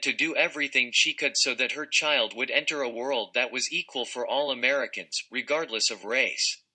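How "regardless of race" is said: usual speaking voice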